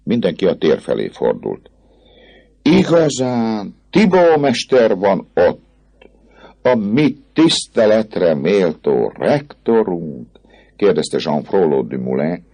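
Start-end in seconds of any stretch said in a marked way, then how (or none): 2.6-10.3 high, very slow, stretched-out query; exaggerated drawling wonder; mock reverent question. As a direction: draw out each word with mock awe. Student mockingly asks about rector.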